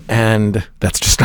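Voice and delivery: usual speaking voice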